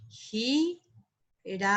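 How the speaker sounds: "Girasol" very slowly in separate pieces, gi, ra, SOL; syllable by syllable. Shown as a demonstration. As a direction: break "Girasol" into three syllables with pauses. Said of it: "Acá hay dos sílabas átonas".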